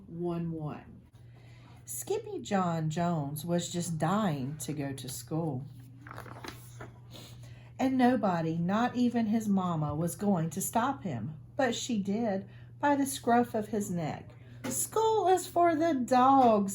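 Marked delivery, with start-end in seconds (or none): 1.9-14.3 put-on lively read-aloud storytelling for kids; animated picture-book narration; storybook read-aloud. 14.6-16.8 higher, slow and emphatic; slow, pointed motherly pronouncement. Acted. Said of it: "stated Mama Junebug Jones emphatically".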